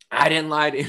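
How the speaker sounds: high, loud mock protest; indignant denial. Airline agent protesting to her manager, mock indignant. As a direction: imitate an outraged agent protesting.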